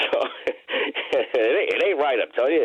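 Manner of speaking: amused protest, words cut with laughter; laughing. Real, not acted. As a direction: chuckle while protesting. Laughing at host's radio jab.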